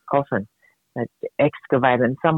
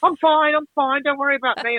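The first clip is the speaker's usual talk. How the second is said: lightly voiced high-pitched bird brushing off a fall; embarrassed bird; 11 semitones higher than usual. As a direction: play a flustered bird insisting it's okay, pitched up. Voicing a dazed, embarrassed bird.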